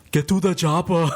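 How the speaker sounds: mock Arnold line. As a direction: bark it like an action hero. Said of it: "gently said".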